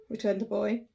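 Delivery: plain reading voice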